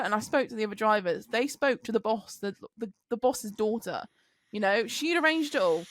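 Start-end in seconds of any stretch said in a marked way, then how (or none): none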